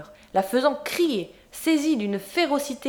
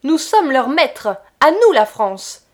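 slow, louder, triumphant proclamation; loud, slow, boastful declaration; growing fervor; 4 semitones higher, 9 dB louder than usual. A narrator's reading. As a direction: proclaim it, more and more worked up. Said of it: "il s'animait".

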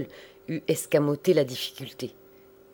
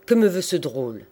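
louder, indignant burst of voice; booming outburst; 2 semitones higher, 5 dB louder than usual. A narrator's reading. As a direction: burst out so the whole hall hears. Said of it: "avec un éclat de voix". (éclat de voix indigné)

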